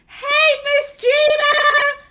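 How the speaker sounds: high, loud, drawn-out squeal of hello; excited shouted greeting. Real, not acted. Host spots her old principal joining, thrilled shout. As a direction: shout it out in real delight, stretching the name. Calling out to arriving former principal.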